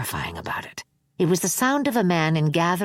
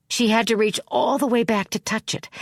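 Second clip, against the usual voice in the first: plain reading voice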